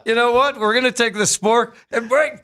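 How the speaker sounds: high, hammed-up excited planning voice; imagined Swiss inventors. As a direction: build from a pitch to a high, giddy cry. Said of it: "They said".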